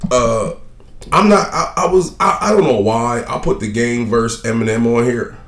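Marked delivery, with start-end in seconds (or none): none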